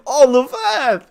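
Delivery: high, loud and slow British proclamation; British narrator impression. Imitating the game narrator in a British voice. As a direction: boom it out like a grand British narrator. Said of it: "I love the way how he said it".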